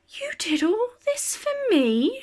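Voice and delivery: put-on high, wondering voice of an old lady overcome with joy; touched old woman's amazed question; Missus Rainbow amazed. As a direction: ask it breathless with disbelief. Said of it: "she asks".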